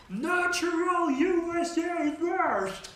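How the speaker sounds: very high, loud, slow mock American accent; overblown American accent. Trump at congress speech in an overblown American accent. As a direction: belt it like a parody American president. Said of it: "he speaks in a completely overblown American accent".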